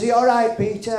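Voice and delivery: nana's voice. Retold or imitated. Nana voiced in a story.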